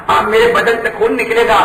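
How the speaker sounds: normal talking voice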